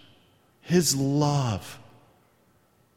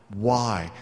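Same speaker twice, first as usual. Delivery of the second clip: usual speaking voice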